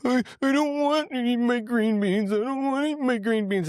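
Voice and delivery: put-on very high whiny child voice; little kid voice. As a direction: whine like a fussy toddler. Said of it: "it sounded like a little kid".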